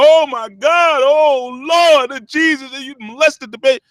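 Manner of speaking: high, loud hammed-up wail; mock horror. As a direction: wail melodramatically to the Lord, mocking overblown sympathy. Mock horrified reaction to a confession.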